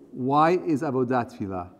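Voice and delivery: ordinary talk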